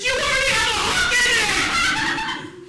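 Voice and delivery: very high, slow, loud yell; incredulous full-volume retort; exasperated shouting. Acted. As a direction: scream it back in comic disbelief. Uncle shouts back at bawling nephew.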